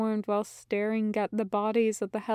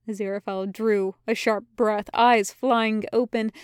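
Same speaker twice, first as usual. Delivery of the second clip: usual speaking voice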